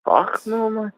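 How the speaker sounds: high put-on Scottish voice; mock Scottish objection; Scottish accent. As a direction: protest in hammed-up Scots. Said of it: "talk with my Scottish accent".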